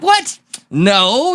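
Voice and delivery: high-pitched mock "what?"; imitating an indignant ex; retold startled denial.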